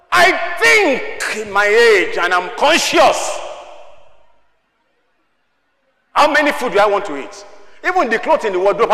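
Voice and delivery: forceful preacher's delivery; preaching at full force. Real, not acted. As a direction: press each question on the congregation.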